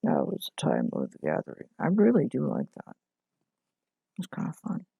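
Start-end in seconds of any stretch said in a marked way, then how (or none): 0.0-1.7 much lower, ominous delivery; ominous deep voice. Pretend. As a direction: intone it like a doom prophecy. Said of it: "Say it again, is it too much?".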